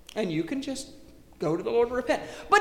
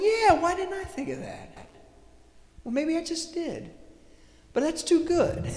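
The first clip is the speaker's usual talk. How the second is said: high, surprised inner voice; light mock astonishment; surprised realization; 9 semitones higher than usual. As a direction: pleasantly caught off guard. Voicing inner thought, surprised.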